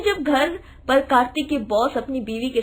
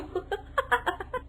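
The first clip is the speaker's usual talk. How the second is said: soft giggle; 7 semitones higher, 10 dB quieter than usual. Acted.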